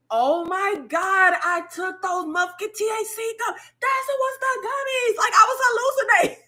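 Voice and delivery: high-pitched, wide-eyed realization rising to a shout; sudden realization. Retold or imitated. Telling her aunt, the shocked high realization. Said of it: "I was like".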